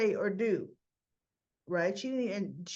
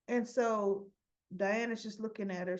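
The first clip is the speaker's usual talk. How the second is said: ordinary talk, nothing marked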